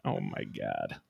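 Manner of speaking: very low, hushed mock dismay; quiet, sunken exasperation; deadpan disbelief. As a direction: sink to the bottom of the voice. Mock reaction to the dead-father letter.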